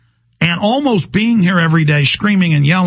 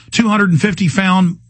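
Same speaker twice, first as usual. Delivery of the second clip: ordinary talk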